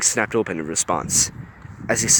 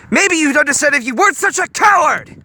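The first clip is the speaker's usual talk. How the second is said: furious yell; 7 dB louder than usual. A narrator's reading. Gogo yelling back at Honey.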